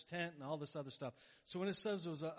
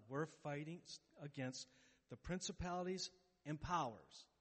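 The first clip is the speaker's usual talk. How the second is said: usual speaking voice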